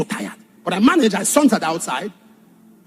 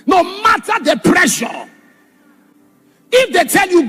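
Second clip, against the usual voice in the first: slow preaching shout; 7 semitones higher than usual. Real, not acted. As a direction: shout each word slowly at the crowd. Urgent warning against witches.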